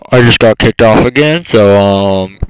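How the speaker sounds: loud, fast exasperated shout; real annoyed shout; frustrated yell. Real, not acted. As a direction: blurt the complaint out loud and quick. Frustrated after getting kicked off.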